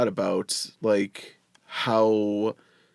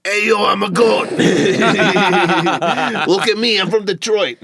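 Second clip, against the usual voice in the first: high, loud bleating goat voice; 9 semitones higher, 7 dB louder than usual. Pretend. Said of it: "Can I get everyone's best goat impression?".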